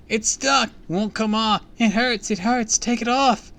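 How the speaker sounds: high, loud, frantic cries; shrill-pitched chorus of panic; terrified screaming. A narrator's reading. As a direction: scream like children trapped in masks.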